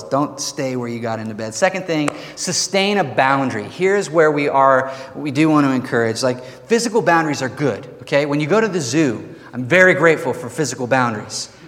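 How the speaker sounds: ordinary talk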